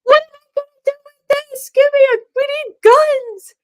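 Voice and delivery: high, loud, fast imitation of a panicked girlfriend; mock panic. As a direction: squeal it like she's buying the apocalypse.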